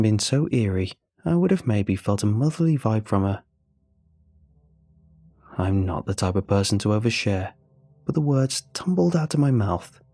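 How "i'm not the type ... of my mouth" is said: ordinary delivery, nothing marked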